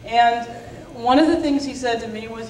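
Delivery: ordinary talk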